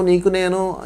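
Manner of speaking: normal talking voice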